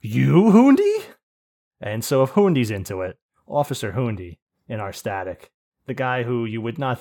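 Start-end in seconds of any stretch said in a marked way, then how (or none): 0.0-1.0 much higher and louder, slow, rising; stretched out in amazed disbelief; surprised disbelief. Retold or imitated. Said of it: "and I'm like".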